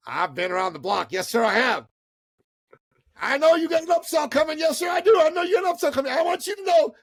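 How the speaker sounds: high, loud comic character voice; cocky wise-guy impression. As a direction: crow it insistently in a high put-on voice. Imitates guy boasting he's wise.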